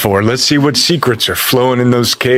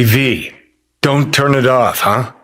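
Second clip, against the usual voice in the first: ordinary talk, nothing marked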